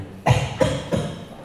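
a short dry cough; a single unvoiced demonstration cough; demonstrated cough. Shown as a demonstration. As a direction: cough once to show the sound. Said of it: "the sound of coughing".

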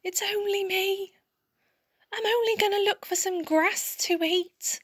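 high, timid squeak; squeaky little goat. A narrator's reading. Littlest goat pleads with the troll, squeaky. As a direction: squeak it out as a tiny quaking goat. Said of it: "managed to squeak".

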